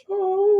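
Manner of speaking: a trapped woman's groan from the dark; pained moan. A narrator's reading. Injured woman moaning from the wreckage, high and loud. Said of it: "a woman moans".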